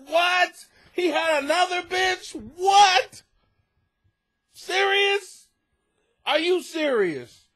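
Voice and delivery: high, loud fake disbelief; sarcastic shock. To the cheated letter writer, mock astonished shouting. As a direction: overplay the gasp of outrage. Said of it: "Did you get the sarcasm?".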